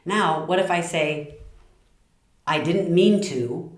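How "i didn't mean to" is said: slow, defensive excuse; not so nice. Shown as a demonstration. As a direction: push it out as a grudging excuse. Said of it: "doesn't sound nearly as nice".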